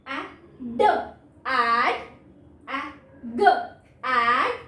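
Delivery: put-on teacher sounding out letters for kids, slow and loud; phonics blending demo. Shown as a demonstration. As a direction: sound out each letter slowly, then blend it. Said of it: "letter words लिखे हैं".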